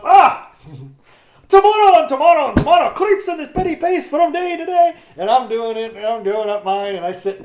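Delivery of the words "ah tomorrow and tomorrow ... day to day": much higher, louder Shakespeare declamation; soaring over-the-top Macbeth; big dramatic recitation. Retold or imitated. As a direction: belt it out like a ham on stage. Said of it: "I'm up".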